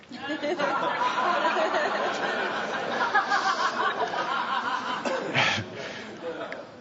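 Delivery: breaks into a long, high, real laugh; a long, genuine burst of laughter. Real, not acted. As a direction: laughs hard at his own punchline. Speaker laughs at own story.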